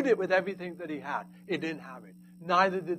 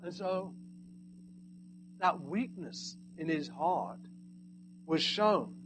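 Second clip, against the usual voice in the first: normal talking voice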